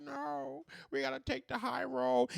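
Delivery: high, soft, put-on pleading; sing-song mockery of noble restraint; mock high-mindedness. Mimics calls to stay noble.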